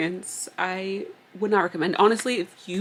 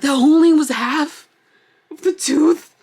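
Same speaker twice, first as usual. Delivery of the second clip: shocked; 8 semitones higher, 9 dB louder than usual. Retold or imitated. Shocked at dentist, retold.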